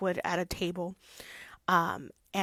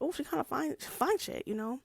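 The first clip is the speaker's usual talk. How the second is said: higher, playful leering voice; flirty Lockwood; 6 semitones higher than usual. Retold or imitated. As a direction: hit on her playfully. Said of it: "Locke was like".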